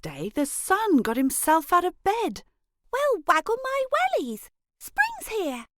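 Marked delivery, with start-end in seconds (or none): 2.9-5.7 put-on much higher, delighted squeal of a little girl; cheering that spring is finally here; excited girl. Child greets spring with a high excited squeal. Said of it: "Elray said".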